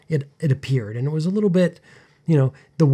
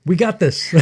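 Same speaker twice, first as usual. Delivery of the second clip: laughing; 5 semitones higher, 4 dB louder than usual. Real, not acted. Laughing agreement.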